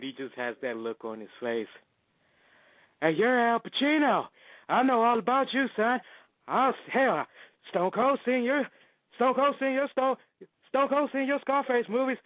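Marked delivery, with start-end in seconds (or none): 3.0-12.2 Stone Cold impression. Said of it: "you just see Stone Cold".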